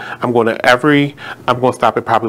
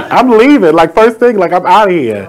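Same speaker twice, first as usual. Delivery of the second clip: higher, louder, laughing with glee; excited; 6 semitones higher, 4 dB louder than usual. Real, not acted. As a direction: gloat over the getaway plan. Gleeful about leaving after winning.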